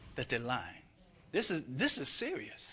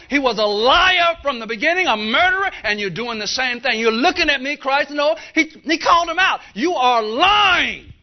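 loud, higher fiery sermon voice; thundering pulpit rebuke, louder and higher; full-force preaching; 7 semitones higher, 12 dB louder than usual. Real, not acted. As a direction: preach it hard and loud to the church.

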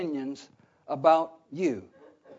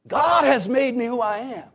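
loud, raised preacher's proclamation; emphatic declaration; 5 semitones higher, 5 dB louder than usual. Acted. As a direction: proclaim it as John's firm answer. Preacher voices John's defiant answer.

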